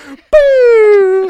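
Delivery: loud, high, drawn-out blast noise; imitated energy blast. Imitating a spirit gun firing, drawn-out blast.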